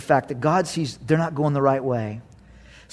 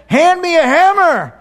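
much higher, louder insistent call; frustrated call; 11 semitones higher, 6 dB louder than usual. Retold or imitated. Worker asking another buddy, higher and louder. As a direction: yell it again, losing patience. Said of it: "you're like".

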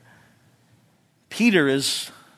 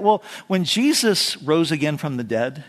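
ordinary talk

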